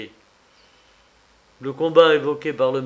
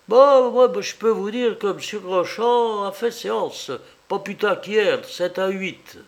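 high-pitched servant voice; 7 semitones higher, 4 dB louder than usual. Acted. Servant Hermance speaks up.

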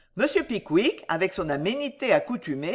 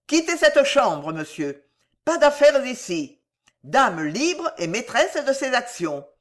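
high, emphatic, drawn-out orders; raised, defiant voice; crying out; 6 semitones higher, 4 dB louder than usual. A narrator's reading. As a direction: order the intruder out indignantly. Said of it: "s'écria Jingle".

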